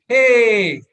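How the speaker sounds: loud high cheer; joyful cheer for the group; cheering hey. Shown as a demonstration. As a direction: shout hey like an excited child. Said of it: "but we will say".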